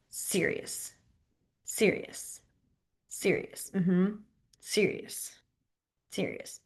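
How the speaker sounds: breathy whispered serious while signing; soft unvoiced serious, serious; whispered repeats. Shown as a demonstration. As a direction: whisper the word over and over. Sign teacher mouthing sign word.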